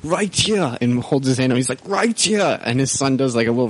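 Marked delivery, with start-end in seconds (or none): 0.0-0.8 higher accented action-hero imitation; Jean-Claude Van Damme. Friend mocking a movie scene, action-star imitation. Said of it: "terrible Jean-Claude Van Damme movie". 1.9-2.6 higher accented action-hero imitation; Jean-Claude Van Damme. Friend mocking a movie scene, action-star imitation. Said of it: "terrible Jean-Claude Van Damme movie".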